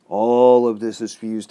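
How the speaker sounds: loud emphatic. Real, not acted. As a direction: drive the point home hard. Climax of a conspiracy rant.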